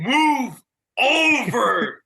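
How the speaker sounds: high drawn-out yell at a slow driver; stretched-out angry yell; screaming. Retold or imitated. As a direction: scream it at the car ahead. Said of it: "he's just screaming".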